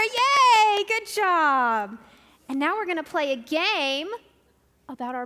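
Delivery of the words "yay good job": much higher, shouted cheer; excited cheer. Acted. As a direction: burst out cheering. Cheering the children's answer.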